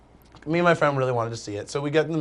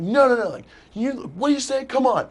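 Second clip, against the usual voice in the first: higher, heated protest; mock angry boyfriend; 9 semitones higher than usual. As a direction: play the furious boyfriend, pitch raised. Angry boyfriend confronting them.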